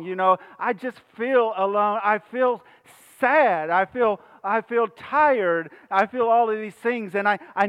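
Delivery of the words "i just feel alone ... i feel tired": usual speaking voice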